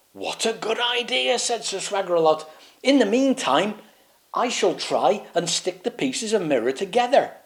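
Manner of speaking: high, grand approving voice; self-important; pompous knight. Acted. Swaggeralot praising the plan, high and grand.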